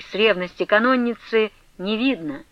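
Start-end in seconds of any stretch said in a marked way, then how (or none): none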